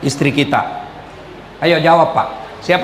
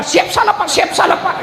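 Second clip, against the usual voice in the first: high mock squeak of a cowed subordinate; cowering yes-man; 11 semitones higher than usual. Husband answering his angry boss at the office, high mock meek squeak. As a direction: squeal yes sir like a scolded clerk.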